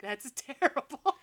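put-on slow, teary little-boy whine; upset little boy. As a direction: play the hurt kid on the verge of tears. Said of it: "the little boy's like".

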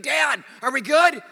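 high, loud, drawn-out plea; imitated frightened daughter. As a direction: yell for dad in mock panic. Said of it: "now I'm actually freaking out".

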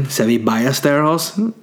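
Ballesteros with a Spanish "y" for the double l; Spanish Ballesteros, "ba-yes-teros"; Spanish pronunciation. Shown as a demonstration. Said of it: "as they would say in Spain".

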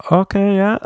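mock baffled agreement, high pitch; high, hesitant "okay, yeah". Comic mocking confused listeners, in a high awkward voice. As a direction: feign acceptance while confused. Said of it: "They were like".

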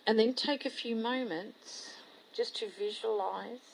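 very soft, unhurried visualisation voice; hushed meditation guide; guided meditation. Real, not acted. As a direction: guide them softly inward, with long pauses.

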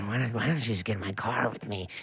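low, soft, creepy come-on. Acted. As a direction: lure him in, low and quiet. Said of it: "What character is that?".

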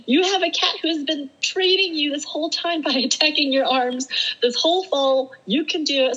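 high, laughing mock pep talk; self pep-talk. Telling herself she can handle ant bites, high pep talk. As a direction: egg yourself on, half laughing. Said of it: "yelling at myself".

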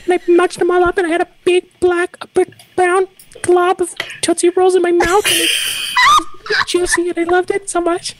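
put-on high kid voice telling a story; little boy voice. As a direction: chatter like a small child. Plays a little boy named Tim.

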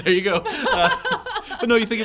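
laughing it off; big laugh while answering. Real, not acted. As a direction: laugh through the words. Laughing after being teased.

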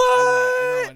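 high, loud excited shout. Real, not acted. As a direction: yell it out, thrilled. Friend excited about new planes.

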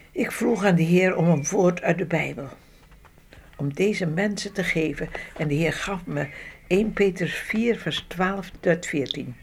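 ordinary talk, nothing marked

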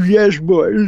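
high, plummy English accent imitating Huxley; Huxley's posh English accent. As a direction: put on Aldous Huxley's upper-class English accent. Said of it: "Huxley say it because he got this inimitable accent".

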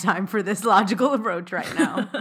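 real giggling complaint about the logical approach; breaking into laughter mid-rant; laughing. Real, not acted.

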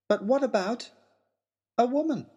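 a hint of a girl unmasking; disguised girl revealing herself; lightly voiced reveal.

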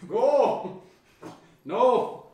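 sharp high loud commands; shouting at dog. Real, not acted. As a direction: bark orders at the dog.

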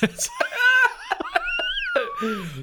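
high shrieking laughter. Real, not acted. Big laughing fit.

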